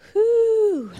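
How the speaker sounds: letting out a big breath; loud high whew. Real, not acted. Preacher catching her breath, a loud high whew. Exhale after ministering.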